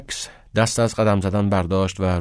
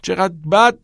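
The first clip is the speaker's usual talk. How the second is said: higher, louder outraged question; indignant echo; 8 semitones higher, 5 dB louder than usual. A narrator's reading. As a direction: throw the words back in disbelief. Knox indignantly echoes.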